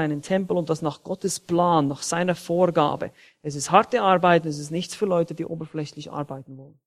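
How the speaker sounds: normal talking voice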